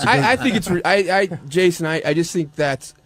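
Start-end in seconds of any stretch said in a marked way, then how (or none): none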